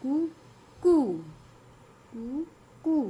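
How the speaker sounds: कू clipped short, then कू drawn out; short and long कू. Shown as a demonstration. Teacher to class, alternating short and long कू. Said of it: "slow बोलेंगे और इसको थोड़ा लंबा बोलेंगे".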